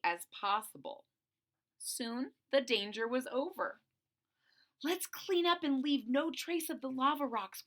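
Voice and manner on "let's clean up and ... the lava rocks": usual speaking voice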